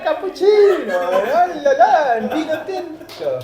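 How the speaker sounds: loud, high, drawn-out singsong parody of Italians; carefree Italian. Retold or imitated. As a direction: ham up a happy-go-lucky Italian, laughing. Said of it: "Italians, they have a very different approach to life".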